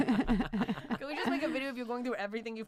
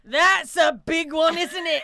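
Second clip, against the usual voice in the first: drawn-out, higher, loud Scottish accent; 6 semitones higher, 8 dB louder, slower than usual. Shown as a demonstration. Comic showing off a Scottish accent to cohosts, hammed-up Scottish. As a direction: put on a big Scottish brogue. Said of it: "I wanna hear a Scottish accent".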